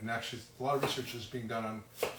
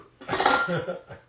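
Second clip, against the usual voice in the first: bursting into a real, bright laugh, louder and higher; 7 semitones higher, 10 dB louder than usual. Real, not acted. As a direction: laugh out loud at your own teary eyes. Laughs at own onion tears.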